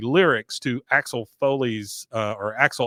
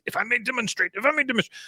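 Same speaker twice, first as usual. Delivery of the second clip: imitating the mother; 11 semitones higher, faster than usual. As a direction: mimic her prim catchphrase. Mimicking mother's catchphrase.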